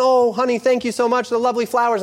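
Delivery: high, gushing thank-you to her husband; delighted wife. Acted. Wife thanking husband for the flowers, high and gushing.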